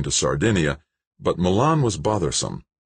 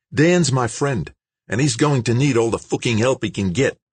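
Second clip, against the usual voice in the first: higher, forceful character voice; insistent big Aussie; 8 semitones higher than usual. A narrator's reading. George demanding to join his friend's rescue, insistent.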